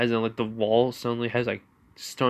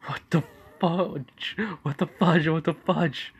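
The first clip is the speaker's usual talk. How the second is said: high, frantic repeated question; freaking out in the dark; panicked; 7 semitones higher than usual. Acted. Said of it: "we're basically going like".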